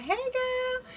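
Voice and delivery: imitating a faked French lilt on a casual hello; French accent. Van giving up the act with a French-accented hello. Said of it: "her own little French way".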